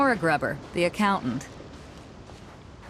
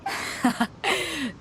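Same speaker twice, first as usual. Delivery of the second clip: short, high satisfied laugh; brief triumphant chuckle; pleased laugh; 9 semitones higher than usual. Acted. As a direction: laugh as the plan comes off. Pleased the signal has come.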